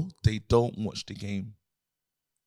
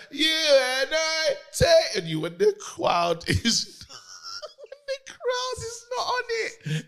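laughing; much slower than usual. Retold or imitated. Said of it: "He said".

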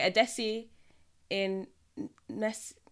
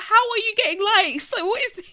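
high, loud retold disbelief; baffled exclamation; 9 semitones higher, 7 dB louder than usual. Telling friends about blurry influencer pictures, baffled and high. Retelling her baffled reaction.

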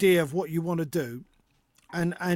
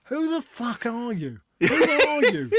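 higher, mock-stunned outburst; stunned disbelief; 7 semitones higher than usual. Dad reacting to his son's pint talk, mock stunned. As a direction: gape at the grown-up son. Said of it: "And I thought".

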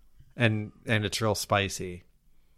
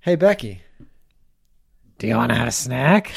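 creepy caller voice; 4 dB louder than usual. Pretend. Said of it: "You sounded like the guy from Scream".

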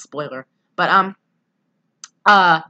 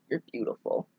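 breathy unvoiced whisper; whispered quote; 11 dB quieter than usual. Podcaster whispering a movie line to her cohost. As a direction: breathe the words, no voice.

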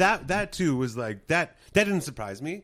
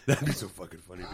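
normal talking voice

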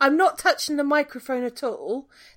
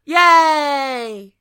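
cheer; 9 dB louder than usual. Real, not acted. Connection back, cheering.

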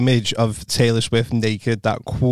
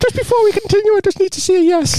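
high squeaky penis character; piping it like a polite little cartoon; high silly voice. Penis voice asking consent.

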